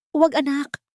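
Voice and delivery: high, shaking plea of a frightened mother; trembling plea. A narrator's reading. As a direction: beg her to stop, voice trembling. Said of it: "ang boses ay nanginginig". (nanginginig na pakiusap)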